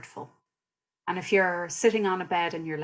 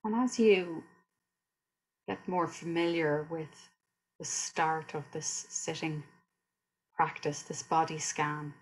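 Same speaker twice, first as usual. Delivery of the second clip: guided meditation; 5 dB quieter than usual. Real, not acted. Teacher leading listeners through a body scan, calm guided meditation. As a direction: guide them gently through the body, unhurried.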